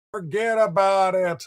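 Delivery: slow, lightly put-on Italian East Coast accent; a quick playful nod to a Staten Island Italian East Coast accent; fond imitation of the Italian East Coast accent of a caller from Staten Island. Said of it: "that, uh, Italian East Coast accent".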